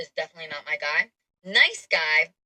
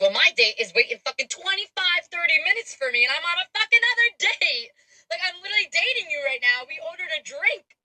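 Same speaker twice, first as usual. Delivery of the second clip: incredulous outburst; 10 semitones higher than usual. Retold or imitated. She exclaims to the wrong date about both waiting dates. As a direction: pitch climbing as the absurdity sinks in. Retelling her laughing outburst.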